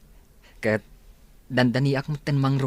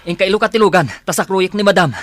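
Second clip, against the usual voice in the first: urgent call; 6 semitones higher, 10 dB louder than usual. Acted. Rushing to carry madam.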